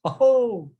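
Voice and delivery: high, loud mock "oh"; acted surprise. Shown as a demonstration. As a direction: exclaim it in hammed-up amazement. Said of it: "So surprise".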